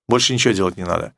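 normal talking voice

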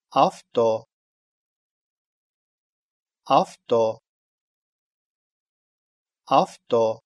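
Авто said with an f sound, afto; в pronounced as ф. Shown as a demonstration. Teacher models mid-word в for learners, Авто said as афто. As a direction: devoice the в in Авто to ф. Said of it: "произносится".

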